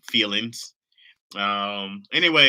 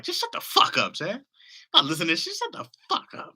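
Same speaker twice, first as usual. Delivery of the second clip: mock annoyed listener; 13 semitones higher, 4 dB louder than usual. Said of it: "it's like".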